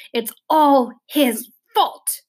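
slow, emphatic angry outburst, each word separate; furious, drawn-out accusation; fury. A narrator's reading. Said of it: "his sadness had changed to fury".

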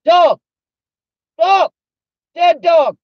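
high, loud repeated yell; loud shouting. Real, not acted. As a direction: bark each word out loud and high. Shouting at someone in chat.

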